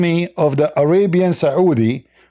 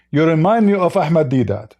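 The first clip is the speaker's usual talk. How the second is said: ordinary delivery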